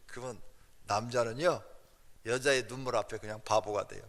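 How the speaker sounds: ordinary talk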